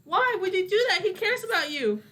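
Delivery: high, loud mock outrage; indignant scolding. Player character scolds another over mask.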